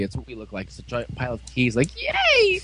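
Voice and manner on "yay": high-pitched squealed yay; mock cheer. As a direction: cheer like a giddy kid in a retelling. Acted cheer in retelling.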